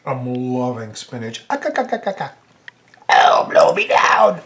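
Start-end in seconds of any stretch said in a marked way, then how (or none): 1.5-2.3 coughing splutter. Real, not acted. Choking on pizza. 3.1-4.4 gravel-whispered sailor exclaim; Popeye voice. Acted. After choking, put-on exclamation.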